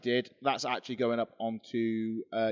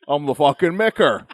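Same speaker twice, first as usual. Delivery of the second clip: Mick Foley; 10 dB louder than usual. Pretend. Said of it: "What's the worst you're gonna say?".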